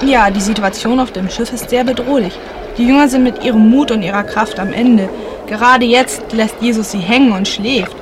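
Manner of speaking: usual speaking voice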